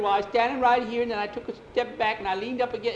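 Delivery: high, loud account; agitated, high-pitched boy; flustered explaining. Acted. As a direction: blurt out your defence in a fluster. Flustered boy explains what he did.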